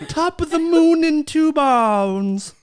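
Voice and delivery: loud, high, drawn-out slurring; ridiculing a botched Irish accent; mock stroke Irish. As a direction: mangle an Irish greeting slowly and loudly. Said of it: "first on-air stroke in podcast history".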